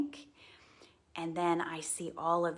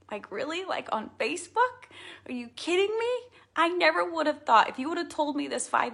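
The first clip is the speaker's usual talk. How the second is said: high, loud disbelief; can you believe it, squealing with surprise; real delighted astonishment; incredulous; 10 semitones higher, 5 dB louder than usual. Real, not acted. Incredulous disbelief about Facebook friends.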